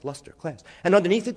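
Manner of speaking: ordinary talk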